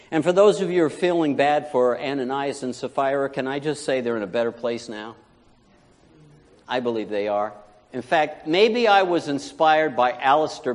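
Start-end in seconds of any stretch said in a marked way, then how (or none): none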